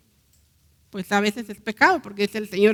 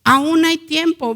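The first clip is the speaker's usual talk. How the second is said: louder repeat; 5 semitones higher, 5 dB louder, slower than usual. Shown as a demonstration. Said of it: "repeated louder".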